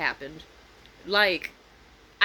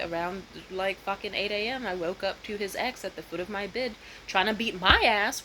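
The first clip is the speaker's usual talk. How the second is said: normal talking voice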